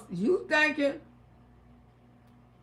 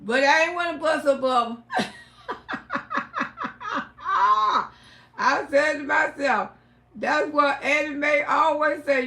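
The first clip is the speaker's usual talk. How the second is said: ordinary talk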